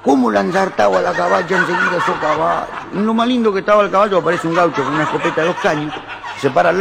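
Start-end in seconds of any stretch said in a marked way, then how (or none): 0.0-2.7 Italian immigrant. Acted. Italian gardener watching horses at the fiesta, Italian-accented marveling. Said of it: "el italiano lo mira y dice".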